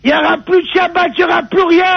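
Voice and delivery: high shouted renunciation; put-on outrage at full voice; acted furious shout. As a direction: shout it out as a staged family break-up. Acted shouting match with brother.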